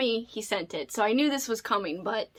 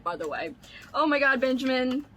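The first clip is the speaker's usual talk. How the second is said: ordinary talk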